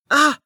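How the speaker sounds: high startled shriek; fake shriek. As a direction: shriek in put-on surprise. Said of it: "Amelia shrieked".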